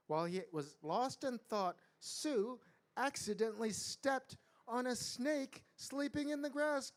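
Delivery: put-on animated read-aloud for children; measured, lively storybook telling; story narration. A narrator's reading. Storyteller reading to a group of children, animated narration.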